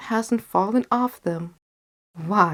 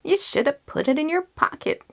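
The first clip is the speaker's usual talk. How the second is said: high-pitched telling-off; scolding mother; 10 semitones higher than usual. A narrator's reading. Mother telling Jack off over the lost penny, high and scolding. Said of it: "said his mother".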